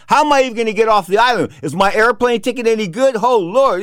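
high-pitched retold worry; freaking out. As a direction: fret aloud in mounting panic. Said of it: "'cause I'm freaking out".

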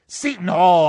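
loud pirate shout of the team name. Pretend. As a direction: bellow it across the deck.

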